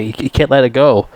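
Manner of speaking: ordinary talk, nothing marked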